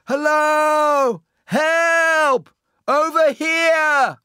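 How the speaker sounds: high, drawn-out full-voiced shouts; shouting for help. A narrator's reading. Gulliver hoping sailors will see him, shouting. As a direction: holler each word to a distant ship. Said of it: "He shouted and waved wildly".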